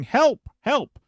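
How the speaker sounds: high-pitched mock cry for help; mock yelling. Host reading a police report of someone on a porch, mock yelling. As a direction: call out as if from a porch, not at full volume. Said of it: "someone was on a porch yelling".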